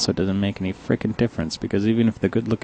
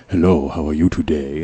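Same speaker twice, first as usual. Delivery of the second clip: lower, louder smooth charmer voice; Richard's low voice; 4 semitones lower, 4 dB louder than usual. Retold or imitated. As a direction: deepen it like the smooth coworker. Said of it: "his voice is really low and he has this faint accent".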